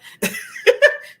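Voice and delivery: high burst of laughter; sudden high laugh. Real, not acted. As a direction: laugh out loud at the memory. Laughs at own story.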